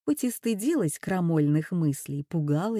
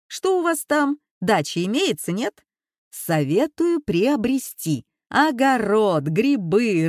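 mock condescending boss; 3 semitones higher, 4 dB louder than usual. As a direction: sneer sweetly as the smug rector. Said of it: "как разговаривал с ним новый начальник".

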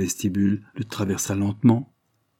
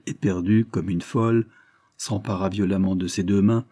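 ordinary delivery

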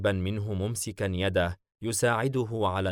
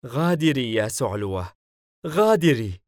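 louder order, rising higher on the repeat; angry dismissal; 4 semitones higher, 7 dB louder than usual. A narrator's reading. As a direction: order her out, building in force. Danhash orders her to leave. (أمر غاضب بالمغادرة)

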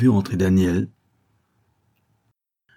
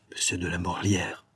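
softer, keeping the name down; said low; 7 dB quieter than usual. A narrator's reading. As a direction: say the name softly so no one else hears. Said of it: "dit-il tout bas".

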